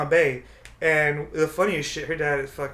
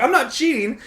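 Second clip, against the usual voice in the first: panicked denial; 10 semitones higher, 5 dB louder than usual. Retold or imitated. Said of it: "I'm like".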